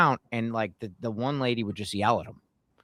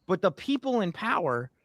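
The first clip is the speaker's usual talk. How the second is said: getting emotional; 4 semitones higher than usual. Real, not acted. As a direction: voice climbing with real frustration. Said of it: "you know I get emotional about this shit".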